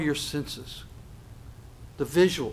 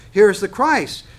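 proclaiming; 5 semitones higher, 9 dB louder than usual. Acted. Preacher reading the false claim again.

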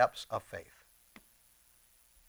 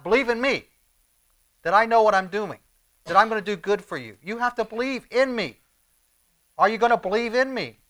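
raised, forceful preacher's appeal; emphatic urging; 7 semitones higher, 5 dB louder than usual. Acted. Preacher voices Jesus challenging his followers, urgent and insistent. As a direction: drive each phrase home, high and loud.

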